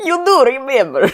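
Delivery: put-on high, botched German accent; hammed-up German accent attempt. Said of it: "I have to try and be German".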